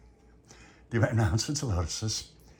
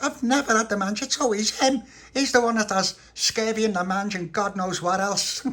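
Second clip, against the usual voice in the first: much higher, louder little creature voice; squeaky little pal; 11 semitones higher, 5 dB louder than usual. Acted. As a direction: pipe up high to blame your friend. Little Pappy blames Tony.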